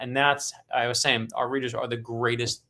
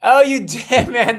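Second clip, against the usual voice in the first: delighted burst, high and loud with a laugh; excited laugh; 9 semitones higher, 10 dB louder, slower than usual. Real, not acted. Guest sees the Viper tattoo, real excited laughing outburst. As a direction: cheer as the surprise lands, laughing.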